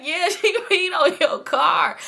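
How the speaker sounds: high, slow, laughing through the words. Real, not acted. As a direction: stretch it out through real laughter. Laughs while retelling her confession.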